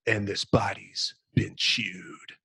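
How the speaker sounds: hushed, slowed and shaken; horrified whisper. Acted. Marine reports the chewed body over the radio, a horrified whisper. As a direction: breathe it out in horror, barely voiced.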